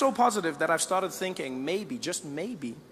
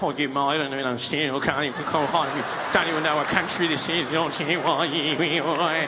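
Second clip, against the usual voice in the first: put-on Australian accent; 4 semitones lower than usual. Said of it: "The Australian was like".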